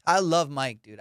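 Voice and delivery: ordinary delivery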